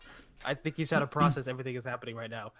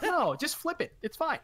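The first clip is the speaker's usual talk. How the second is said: higher-pitched quote of mom; imitated mom; 12 semitones higher than usual. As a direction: quote her in a high, light voice. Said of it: "And she's like".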